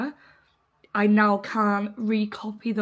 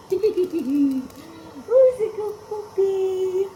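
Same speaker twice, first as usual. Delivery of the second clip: dog voice; 9 semitones higher, 4 dB louder, much slower than usual. Real, not acted. Owner cooing at her puppy in dog voice. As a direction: coo the question at the puppy. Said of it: "talking a dog voice all week".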